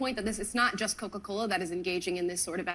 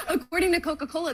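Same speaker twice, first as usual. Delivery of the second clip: laughing; 8 semitones higher, 4 dB louder, faster than usual. Real, not acted. Laughing while making the point.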